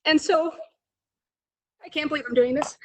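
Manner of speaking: choked up and close to crying; emotional. Real, not acted. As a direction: voice breaking, fighting back tears. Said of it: "I'm sorry, I get emotional".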